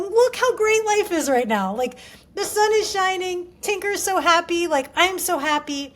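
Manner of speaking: re-enacted upbeat pep talk; excited encouragement. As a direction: gush with delight to encourage a child. Cheering on niece in retold talk.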